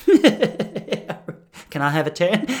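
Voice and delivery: slow, drawn-out creepy ask; creepy request. Retold or imitated. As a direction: stretch it out like a leering fan. Creepy fan asks for turn.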